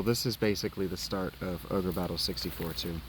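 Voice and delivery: usual speaking voice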